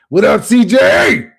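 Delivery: slow, high, very loud holler; shouted greeting. Real, not acted. Greeting CJ in the chat, high drawn-out shout. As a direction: holler it out, stretching the words.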